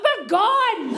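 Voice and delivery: high, thrilled shout; excited. Retold or imitated. Wife overruling husband, shouting with glee. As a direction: leap at the invitation. Eager to go to party.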